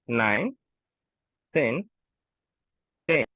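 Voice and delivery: ordinary talk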